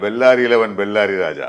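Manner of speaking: loud, slow character introduction; film dialogue voice. Shown as a demonstration. Dubbing artist recalls another film line, loud and deliberate. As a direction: declaim it like a film punchline. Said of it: "എന്നൊക്കെ പറഞ്ഞിട്ടൊരു കുറേ ഡയലോഗാണ്".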